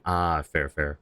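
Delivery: ordinary talk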